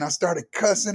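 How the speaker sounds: ordinary talk, nothing marked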